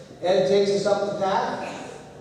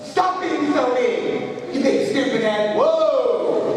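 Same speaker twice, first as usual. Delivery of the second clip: slow, high, loud playground taunt; mocking shout; 8 semitones higher, 3 dB louder, slower than usual. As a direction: yell it like a bratty kid. Acting out a kid's taunt.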